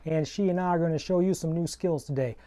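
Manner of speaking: howdy partner, hammed-up Southern cowboy; phony cowboy accent. Cowboy host greeting preschoolers in a Southern accent. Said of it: "I dropped my phony cowboy accent".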